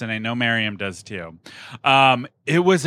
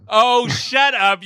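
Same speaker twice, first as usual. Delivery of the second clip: mock outrage; 10 semitones higher, 5 dB louder, much slower than usual. Teasing friend who dissed [name].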